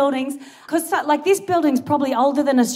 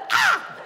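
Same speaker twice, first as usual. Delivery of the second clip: very high imitated bird squawk; short, high mock screech; Ratbird screech; 4 dB quieter than usual. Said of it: "You know, Ratbird?".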